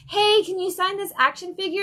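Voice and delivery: high-pitched imitation of an eager kid; kid fan. Kid asking an artist to sign a toy, high mock child voice. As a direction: lightly mimic a child asking for an autograph. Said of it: "a kid comes in".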